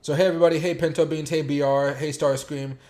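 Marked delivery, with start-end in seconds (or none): none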